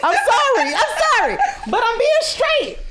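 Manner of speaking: high, loud talk through laughter; giddy high laughing voice. Real, not acted. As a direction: speak while cracking up. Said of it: "I'm about to cry".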